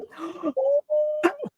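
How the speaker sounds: long, high-pitched mock gasp; drawn-out acted gasp of surprise; shocked gasp. Acts out reaction to surprise actor.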